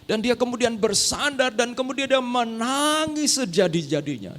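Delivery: ordinary talk, nothing marked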